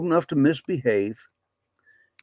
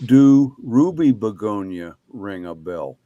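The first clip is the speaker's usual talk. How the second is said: punchline delivery; 3 semitones lower, 7 dB louder, slower than usual. Shown as a demonstration. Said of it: "say the punchline again".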